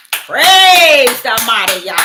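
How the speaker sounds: slow, booming praise shout; loud slow praise. Retold or imitated. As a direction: proclaim it slowly and loudly. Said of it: "Guess what I said?".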